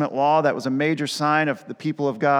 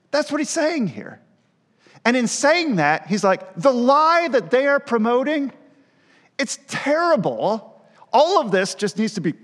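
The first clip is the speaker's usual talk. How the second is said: paraphrasing Paul; 5 semitones higher than usual. Retold or imitated.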